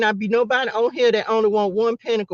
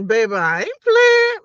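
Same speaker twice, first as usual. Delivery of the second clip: high and slow, drawn out; sassy insistence; 10 semitones higher, 4 dB louder than usual. Real, not acted. Insisting to the viewers, high and drawn out. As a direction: lean into each word, high.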